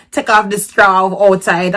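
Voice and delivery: usual speaking voice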